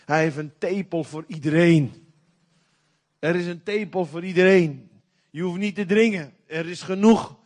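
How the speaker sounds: loud, ringing preacher proclamation; emphatic preaching. Acted. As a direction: declare it to the whole hall. Preacher builds to emphatic call. (luid predikend uitroepen)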